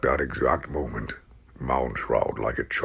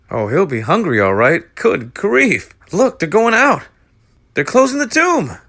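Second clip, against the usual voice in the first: put-on high loud frantic boy; panicked shout; 12 dB louder than usual. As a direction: cry out in agony. Agonized Tom.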